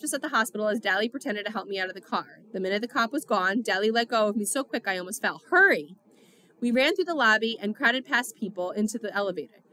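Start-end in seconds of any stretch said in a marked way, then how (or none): 5.5-5.9 loud, urgent yell to hurry; urgent shout. A narrator's reading. Dally shouting at Pony to hurry, loud and urgent. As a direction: bark it out to get him moving.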